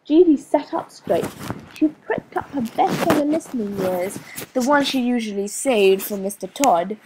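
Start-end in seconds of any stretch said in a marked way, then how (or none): none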